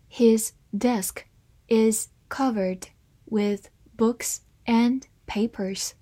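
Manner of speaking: slow, deliberate sentence reading. Shown as a demonstration. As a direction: read it slowly for learners. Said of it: "好，慢慢来读". (慢慢朗读)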